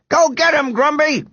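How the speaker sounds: high-pitched egging-on yell. A narrator's reading. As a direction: cheer on a neighbour from across the street. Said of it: "yelled the man across the street".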